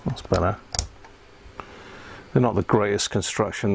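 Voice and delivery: ordinary talk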